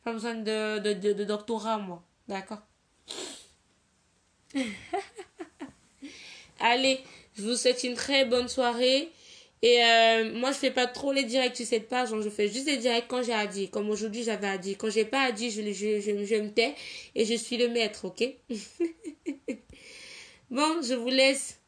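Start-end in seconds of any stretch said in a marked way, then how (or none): 4.5-5.7 soft, higher-pitched chuckle; quiet giggle falling away; laugh. Real, not acted. Speaker to viewers after saying she needs no doctorate, soft laugh. 18.3-19.6 quiet, higher-pitched giggle; laugh. Real, not acted. Speaker to viewers after saying she is the master, soft laugh.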